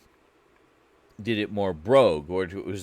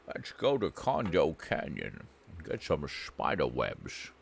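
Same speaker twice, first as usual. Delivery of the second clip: lower, softer Scottish accent imitating a friend; lightly voiced lower Scottish imitation; imitating Funk Monk; 4 semitones lower, 5 dB quieter than usual. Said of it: "It's exactly what Funk Monk sounds like".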